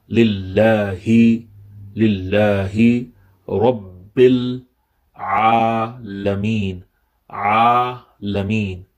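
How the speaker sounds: "Alameen" drawn out slowly with ain; slow recitation. Shown as a demonstration. Teacher recites slowly for students to copy. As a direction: recite slowly, shaping each sound. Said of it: "from the middle of the throat".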